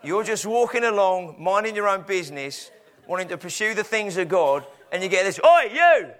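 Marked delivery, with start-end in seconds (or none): 5.4-6.1 high, loud mock heckle; shouting. Devil accosts someone minding their own business, shouting. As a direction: yell it like a rude parrot hailing someone. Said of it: "whatever he shouts at you".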